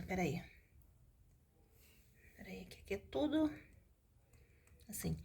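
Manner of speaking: very soft, half-whispered muttering; muttering to self. Real, not acted. Teacher changing the page mid-lesson, muttering to self. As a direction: mumble under your breath while searching.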